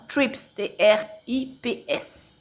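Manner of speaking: "trips" said, then T R I P S spelled slowly. Shown as a demonstration. Crafter clarifying it is not trees, spelling "trips" slowly. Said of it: "Pas trees, hein, pas les arbres".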